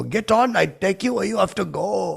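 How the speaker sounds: loud, high cabbie holler; high-pitched, shouted cabbie voice; New York cabbie character. Retold or imitated. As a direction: call passengers aboard as a hammy cabbie. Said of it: "I'm wearing a cabbie hat".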